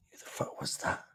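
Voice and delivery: hushed unvoiced whisper. Real, not acted. Fan baffled by a dreadful corner, whispering. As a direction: breathe it out in disbelief.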